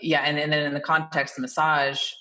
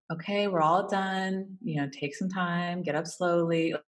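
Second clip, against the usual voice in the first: spa voice; 3 semitones higher, slower than usual. Shown as a demonstration. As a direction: ease them up gently and slowly. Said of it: "I'm still using the spa voice".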